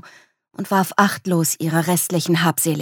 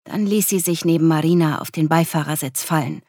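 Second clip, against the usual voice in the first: ordinary delivery, nothing marked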